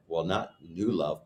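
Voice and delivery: normal talking voice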